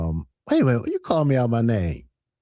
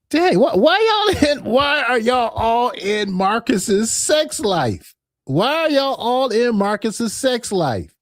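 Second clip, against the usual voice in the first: exasperated call-out; 10 semitones higher, slower than usual. Real, not acted. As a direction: throw the question at the crowd in disbelief. Host calling out nosy chat.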